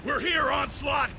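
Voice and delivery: calling out arrival. Acted. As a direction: announce your arrival with a shout. Arriving reinforcements call out.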